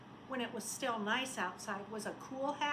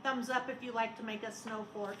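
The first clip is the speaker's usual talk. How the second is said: plain reading voice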